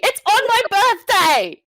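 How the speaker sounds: high excited repeat; excited shout. Real, not acted. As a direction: cry it again in delight. Tour date on her birthday.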